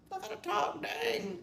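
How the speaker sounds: high, soft put-upon sigh of a line; grudging, cornered into talking; reluctant dang. Retold or imitated. Retold inner reaction.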